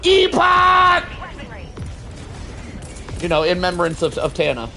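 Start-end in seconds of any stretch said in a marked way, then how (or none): 0.0-1.0 high, loud battle scream; screamed Epoch. Pretend. Screaming his weapon name while firing, loud battle cry. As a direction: yell the weapon's name full force. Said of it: "screamed Epoch at the top of my lungs".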